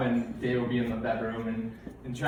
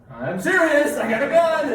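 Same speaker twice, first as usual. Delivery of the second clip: high, loud hammed-up yelling of a startled dad; mock panicked shout; 13 semitones higher, 8 dB louder than usual. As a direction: bellow it like you just woke to a burglar. Dad insists, louder.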